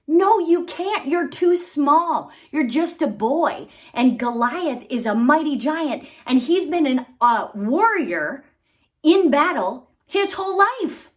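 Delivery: put-on a king waving off a boy; King Saul doubting. A narrator's reading. King Saul refusing David.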